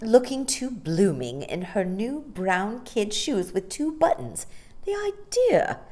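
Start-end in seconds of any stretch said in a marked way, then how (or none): 4.8-5.8 indignant thought. Acted. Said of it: "heard her thinking".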